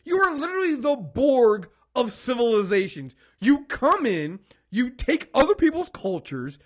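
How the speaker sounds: loud, high, fired-up rant; heated rant. Real, not acted. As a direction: let it all out, shouting at them. Ranting about cultural appropriation.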